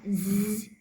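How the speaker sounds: "Z" held as a buzz; voiced Z. Shown as a demonstration. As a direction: sustain a voiced "Z". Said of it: "Z has voice".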